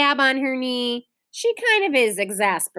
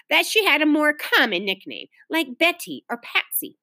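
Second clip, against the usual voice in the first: usual speaking voice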